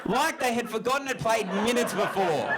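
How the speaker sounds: high, incredulous comic rant; hammed-up indignation; mock exasperation. Exasperated at crowd's short memory.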